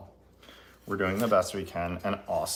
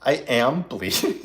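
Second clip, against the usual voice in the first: louder, laughing through it; 4 semitones higher, 5 dB louder than usual. Real, not acted. Telling the stream she's bleeding, laughing. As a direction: giggle out the bad news.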